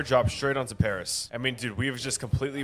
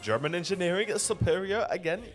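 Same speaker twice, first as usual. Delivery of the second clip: mock German accent, pitched up and proud; playful fake German accent; zesty German accent; 6 semitones higher than usual. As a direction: ham up a German accent, boasting. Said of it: "I sound like a zesty German now".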